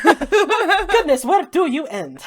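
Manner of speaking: high, loud marvelling through laughter; playful astonishment; amused amazement. Acted. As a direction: exclaim it, laughing at the sheer size. Acted marvelling at Cassandra's height.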